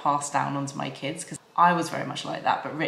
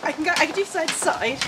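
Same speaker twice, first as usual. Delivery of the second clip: breathless excitement; 11 semitones higher, 4 dB louder, faster than usual. Real, not acted. Skipping while talking, out of breath.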